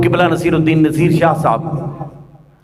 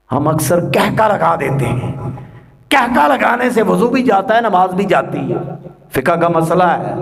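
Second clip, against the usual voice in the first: normal talking voice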